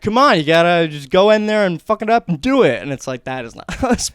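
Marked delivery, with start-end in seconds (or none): 0.0-2.8 loud, higher coach-style push; forceful mock pep talk; sports guy. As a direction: imitate a pushy jock barking encouragement. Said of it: "like a sports guy".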